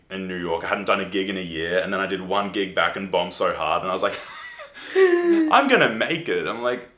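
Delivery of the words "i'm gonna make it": high, slow and laughing through it; laughing defiance. Retold or imitated. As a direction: laugh out a stubborn promise to yourself. Retold stubborn self-belief.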